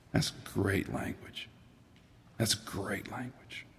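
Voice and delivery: hushed, slow whisper of awe; a whispered, slow "That's great language"; awed whisper. Real, not acted. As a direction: breathe it out, moved.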